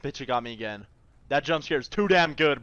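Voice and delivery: ordinary delivery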